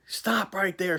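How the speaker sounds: loud, high barked order to stop; retold scream. Trooper screaming at him as he walks out, loud high yell. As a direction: yell it like a trooper cornering someone. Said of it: "she's screaming".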